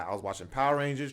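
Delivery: usual speaking voice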